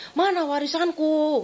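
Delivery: nagging mother for inheritance, drawn out; whiny demand to mother. Retold or imitated. Streit mit Mutter ums Erbe.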